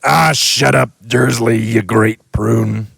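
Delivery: loud, slowing scorn; dismissive giant. Acted. The giant waving off Vernon, loud then slow. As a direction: brush him off. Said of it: "said the giant".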